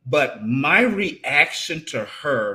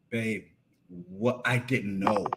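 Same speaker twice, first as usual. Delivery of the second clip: much softer, lower stunned murmur; broken; 6 semitones lower, 6 dB quieter than usual. Retold or imitated. Husband realizing wife's pain, soft broken murmur. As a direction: say it gently, heartbroken. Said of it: "And I broke".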